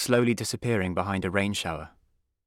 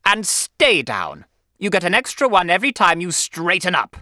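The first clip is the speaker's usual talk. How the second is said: loud, raised headmaster voice; forceful schoolmaster's command; stern order; 9 semitones higher, 8 dB louder than usual. A narrator's reading. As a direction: lay down the threat with full authority. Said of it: "Mister Coombs ordered".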